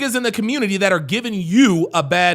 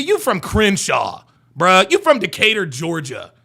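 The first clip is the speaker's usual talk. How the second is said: ordinary delivery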